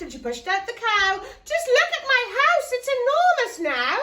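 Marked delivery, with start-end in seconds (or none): 1.4-4.0 higher, louder joyful exclaim; thrilled at the space; delighted. Acted. Old lady delighted with her big house, joyful.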